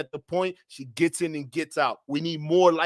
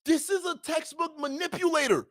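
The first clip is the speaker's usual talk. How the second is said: high-pitched outraged exclaim; a real, incredulous high cry of outrage; shocked indignation, way up high; outraged disbelief; 10 semitones higher, 4 dB quieter than usual. Real, not acted. Host outraged at guest.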